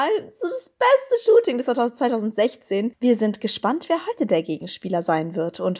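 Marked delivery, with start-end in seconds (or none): 0.0-1.6 excited gushing. Real, not acted. Gushing about favourite shoot.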